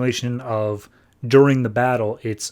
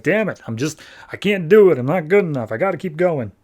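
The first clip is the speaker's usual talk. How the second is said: higher-pitched mock exasperation; retold frustration; 5 semitones higher, 4 dB louder than usual. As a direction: act out a frustrated hero pushing on. Retold hero failing and retrying.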